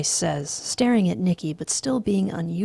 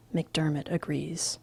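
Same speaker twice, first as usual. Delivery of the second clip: ordinary delivery, nothing marked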